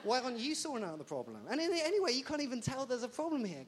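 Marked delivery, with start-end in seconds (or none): 1.5-3.6 mock carefree. Mock dismissive gangrene sufferer.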